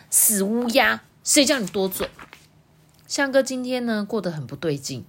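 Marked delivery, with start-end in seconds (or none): 0.1-2.1 凶乌鸦. A narrator's reading. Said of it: "揪住这个多多说".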